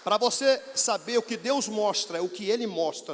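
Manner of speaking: bem mais alto e mais agudo, proclamando; pregação em alta voz. Real, not acted. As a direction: proclama a glória de Deus à igreja. Clímax da pregação do testemunho. (brado de pregador no clímax)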